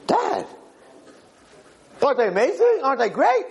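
high-pitched boastful questions; excited kid. Acted. Kid seeking praise from his father, excited. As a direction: squeal for Dad's approval.

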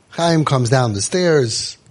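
ordinary talk, nothing marked

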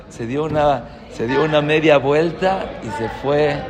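ordinary delivery

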